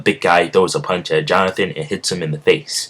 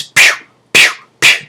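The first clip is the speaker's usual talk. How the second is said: punch sound effects; 7 dB louder than usual. Acted. Mimics punches on the ground.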